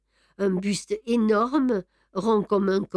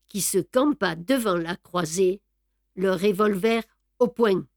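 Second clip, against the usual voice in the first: ordinary delivery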